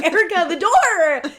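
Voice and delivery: high, loud warning shout; alarmed yell; panicked shout. Retold or imitated. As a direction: yell a warning at a closing door. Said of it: "I was like".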